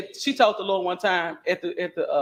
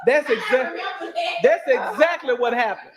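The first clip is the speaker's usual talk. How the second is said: laughing; 7 semitones higher, 3 dB louder than usual. Real, not acted. Laughing agreement about old story.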